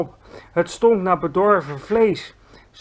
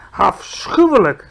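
slow, loud disgust; 3 semitones higher, 8 dB louder than usual. A narrator's reading. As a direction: recoil at the idea. GVR disgusted. (langzaam, vol afschuw)

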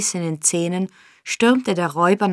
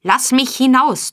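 higher, louder furious demand; raging; 6 semitones higher, 5 dB louder than usual. A narrator's reading. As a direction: rage at the locked door. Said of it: "tobte Sykes".

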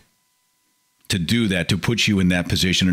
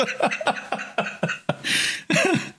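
silent high wheezing laugh; 5 dB quieter than usual. Real, not acted. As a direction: laugh breathlessly at the joke. Laughing at own joke.

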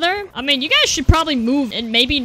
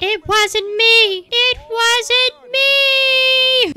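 high, very slow mock sobbing wail; hammed-up wail; 10 semitones higher, 5 dB louder, much slower than usual. Gamer pretending to wail innocence, slow and high. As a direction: ham up a tearful plea.

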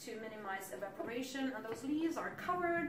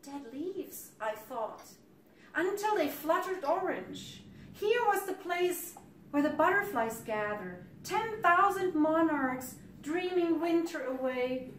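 lifted, lyrical recital; higher verse, swelling toward the butterflies; poem reading; 5 semitones higher, 5 dB louder than usual. Acted. Poet recalls monarchs wintering in the grove, lifted lyrical recital.

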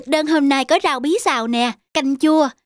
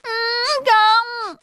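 put-on high, sing-song whine of a spoiled boy; boy throwing a tantrum at dinner, whining; whining child tantrum; 12 semitones higher than usual. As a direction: chant for ice cream like a sulky kid. Child keeps refusing.

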